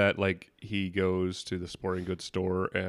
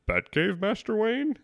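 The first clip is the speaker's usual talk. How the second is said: puzzled butler; 12 semitones higher than usual. Retold or imitated. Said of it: "And Alfred's like".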